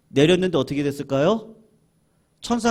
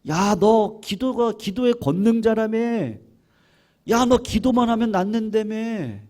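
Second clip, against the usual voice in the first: tempting devil; 8 semitones higher, 4 dB louder than usual. Acted. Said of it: "마귀가 찾아와가지고 속삭이는 거예요".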